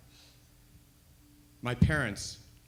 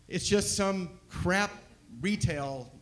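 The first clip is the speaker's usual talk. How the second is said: normal talking voice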